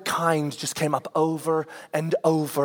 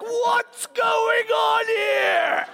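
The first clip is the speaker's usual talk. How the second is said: put-on drawn-out, much higher angry parent yell at the kids; retold angry shout; much slower than usual. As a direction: bellow it slowly down the hallway. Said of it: "I'm shouting at them".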